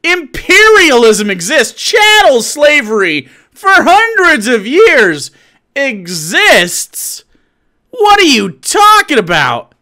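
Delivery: high, loud, incredulous outburst; loud, indignant yelling; outraged shouting. Real, not acted. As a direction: shout it in disbelief, drawing out key words. Outraged retort to played clip.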